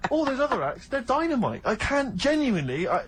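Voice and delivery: ordinary delivery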